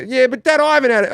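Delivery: high-pitched mock-exasperated complaint; exasperated protest. Son arguing with his dad over funeral food, exasperated.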